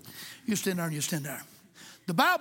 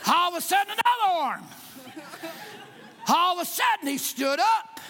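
dramatic build; 6 semitones higher than usual. Acted. Preacher to the congregation, a rising dramatic build. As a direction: build the tension, then burst out on "he stood up".

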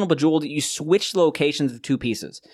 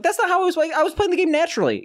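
high mock voice; 14 semitones higher, faster than usual. Mock quote of himself.

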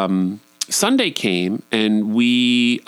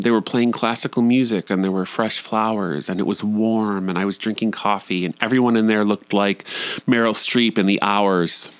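ordinary delivery

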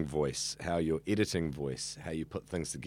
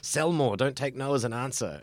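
lightly voiced sales boss, higher in pitch; pushy sales boss; 6 semitones higher than usual. Sales boss urging on the tech support and sales staff, mildly mock pushy. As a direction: imitate a boss pushing for sales. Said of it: "somebody's saying".

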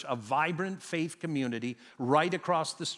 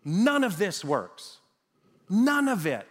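emphatic, blunt dismissal, higher on the repeat; 4 semitones higher, 5 dB louder, slower than usual. Real, not acted. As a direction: hammer it out bluntly. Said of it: "let me be blunt".

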